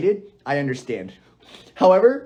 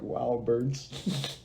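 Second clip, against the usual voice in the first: mock bird watcher; 6 semitones lower, 12 dB quieter than usual. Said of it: "go outside and go like".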